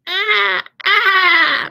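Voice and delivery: high, loud bright cartoon squeal; SpongeBob voice. Shown as a demonstration. Teacher demonstrating a small bright cartoon voice, high loud squeal. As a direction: push it far forward and up into a tiny bright sound. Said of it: "very, very bright".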